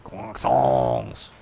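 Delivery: low, drawn-out mock French accent; deep, exaggerated French "Croissants"; bad French accent. Shown as a demonstration. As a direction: ham up a terrible French accent. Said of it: "bad French accent".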